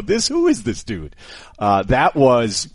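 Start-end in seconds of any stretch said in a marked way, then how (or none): 0.0-1.2 mock surprise. Co-host hearing [name]'s ringtone, high and startled. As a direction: jump up in disbelief at the ringtone. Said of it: "you just go".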